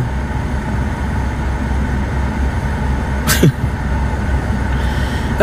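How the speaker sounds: long breathy mocking laugh, no voice. Real, not acted. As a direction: laugh it off in disbelief. Laughs at the claim. (tawa panjang mengejek tanpa suara)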